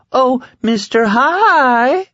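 very high, drawn-out, loud coy teasing; coy falsetto. A narrator's reading. Man teases his friend's new name, coy falsetto. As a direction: coo it slowly in a silly falsetto. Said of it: "said in a coy falsetto".

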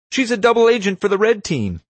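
very high, loud panicked accusation; squawking. A narrator's reading. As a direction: squawk it out to everyone within earshot. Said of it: "he squawked".

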